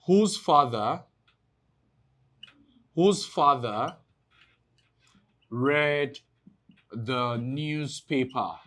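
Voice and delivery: very slow, stretched words with long gaps; slow dictation. Real, not acted. Teacher writing out the question for class, slow dictation. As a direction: dictate it piece by piece, drawing out each phrase.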